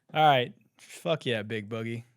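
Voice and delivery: ordinary delivery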